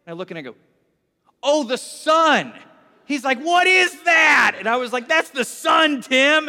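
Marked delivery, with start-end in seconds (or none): 1.4-2.5 loud, high, drawn-out marvel; mock amazement. Mock amazement at seeing sun. 3.4-4.5 high, drawn-out shout; mock astonishment. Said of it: "I joined him in his fun". 5.1-6.5 loud, high mock-amazed announcement; playful excitement. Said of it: "I joined him in his fun".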